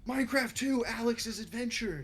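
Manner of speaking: high, drawn-out title reveal; mock sequel announcement. Co-host jokingly announcing a Minecraft sequel, mock excited.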